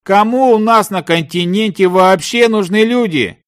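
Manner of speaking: high-pitched rhetorical protest; exasperated pleading. A narrator's reading. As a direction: plead with him to see sense. Partner's exasperated plea continues. (отчаянное возмущение)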